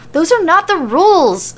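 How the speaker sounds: high, loud protest; crying out. Acted. Sammy protests the count, high and loud. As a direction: shout the complaint in alarm. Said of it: "Sammy cries".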